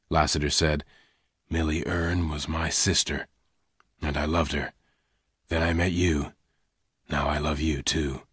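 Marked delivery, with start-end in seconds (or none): 1.5-8.3 lower, softer reassurance; calm her quietly; low soft gunman. Acted. Lassiter confides.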